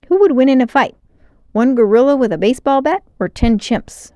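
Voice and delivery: loud, eager question; eager blurt. A narrator's reading. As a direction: blurt it out excitedly.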